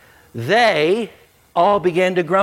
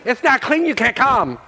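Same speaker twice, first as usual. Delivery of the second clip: wife freaking out; 10 semitones higher, 5 dB louder than usual. Retold or imitated. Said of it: "My wife would freak out".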